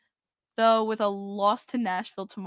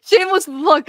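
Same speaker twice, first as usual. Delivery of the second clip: louder, higher mock announcer plug; loud repeated plug; 6 semitones higher, 8 dB louder than usual. Real, not acted. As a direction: sell it like an ad, louder. Self-promoting vlog plug, repeated.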